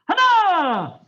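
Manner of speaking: long, loud falling call; shouted count. Real, not acted. Instructor counting kicks for the class, shouted command. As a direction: bellow it out, stretched and falling.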